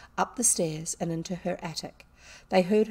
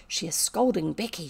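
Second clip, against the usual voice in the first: plain reading voice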